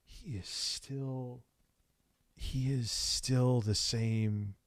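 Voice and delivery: slow and drawn out. Real, not acted. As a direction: spell it out slowly. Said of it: "slow deliberate emphasis".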